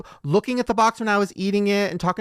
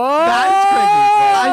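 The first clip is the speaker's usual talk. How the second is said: high, loud, slow amazement; amazed; 14 semitones higher, 9 dB louder, much slower than usual. Real, not acted. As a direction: marvel loudly, much higher. Amazed by reversed song.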